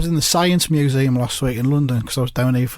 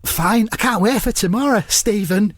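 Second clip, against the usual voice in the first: higher-pitched comic impression; Mark Lawrenson; 6 semitones higher than usual. Mark on the eve of the opening, in high impression. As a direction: say it in the character's raised voice. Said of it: "I'll just say them as Mark".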